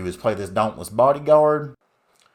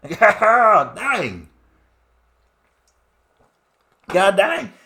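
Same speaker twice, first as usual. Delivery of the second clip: much higher and louder, whooping; excited outcry; 9 semitones higher, 5 dB louder, slower than usual. Acted. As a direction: holler it out in disbelief. Celebrating a lucky opponent's draw.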